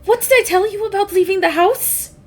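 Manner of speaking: put-on high, scolding older-sister voice. Sister scolding runaway kids.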